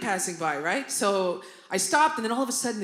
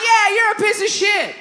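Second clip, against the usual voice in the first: high, loud backing-up shout; retold yelled insult; 11 semitones higher, 8 dB louder than usual. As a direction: pile on at full volume. Backs up mother's yelled insult.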